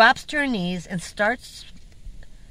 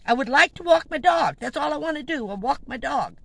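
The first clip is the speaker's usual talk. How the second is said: higher and louder, fed up; exasperated raised repeat; 7 semitones higher, 4 dB louder than usual. Retold or imitated. To the woman, repeating louder to be left alone. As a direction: push the line up, insisting.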